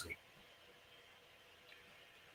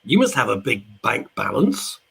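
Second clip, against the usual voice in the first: Ken Dodd impression; 3 semitones higher, 8 dB louder, slower than usual. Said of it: "Ken Dodd then looks at my fifty-seven-year-old father and goes".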